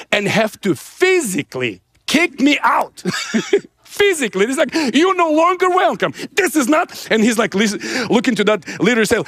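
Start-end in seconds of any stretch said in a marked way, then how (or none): 4.9-7.1 imitating a pastor's high-pitched rebuke; indignant pastor. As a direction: throw someone out, voice raised high. Pastor kicking him out.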